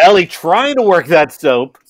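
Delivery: laughing. Real, not acted. Playful bath narration.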